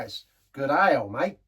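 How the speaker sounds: mock Australian "good ale, mate" in a light Aussie accent. Host joking how Australians would say the beer name, light Australian accent. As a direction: toss it off like a cheery Aussie. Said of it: "Or if you're in Australia".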